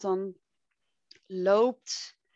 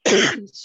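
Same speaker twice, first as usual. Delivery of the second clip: throat clearing; 13 dB louder than usual. Real, not acted. Dry throat, clears it loudly.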